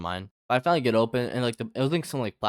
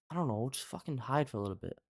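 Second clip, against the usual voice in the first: urgent hushed voice; 3 semitones higher, 7 dB quieter than usual. Retold or imitated. Hiding from the homeless guy.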